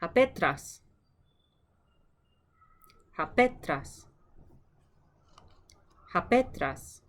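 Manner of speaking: Hapetras, said clearly with a falling tune; Greek name modelled. Shown as a demonstration. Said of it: "Your turn".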